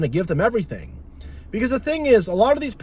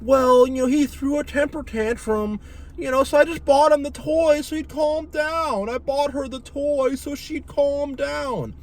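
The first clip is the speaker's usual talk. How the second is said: mock parent; 8 semitones higher than usual. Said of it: "They're like".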